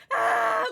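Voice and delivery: high, loud mock scream; panicked scream. Child with head stuck, screaming in panic. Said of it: "completely freaking out".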